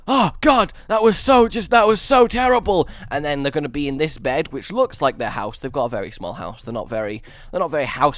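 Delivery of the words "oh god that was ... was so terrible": loud, high acted alarm; hammed-up shaken relief; waking in shock. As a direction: wake from a nightmare, rattled. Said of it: "going to wake up and be like".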